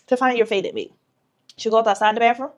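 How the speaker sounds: ordinary talk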